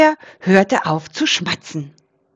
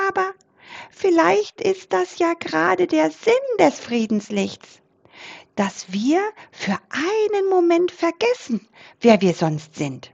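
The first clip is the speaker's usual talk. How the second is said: high, timid little mouse voice; small tentative squeak; cautious mouse squeak; 10 semitones higher than usual. A narrator's reading. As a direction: pipe up carefully, high-pitched. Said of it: "piepste vorsichtig".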